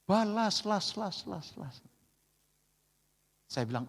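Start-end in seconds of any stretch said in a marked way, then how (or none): 0.0-1.8 repeated syllable fading into an echo; mock echo of the wife's yell; echo fading away. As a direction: let the last word echo and die out. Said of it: "sampai ada ehonya".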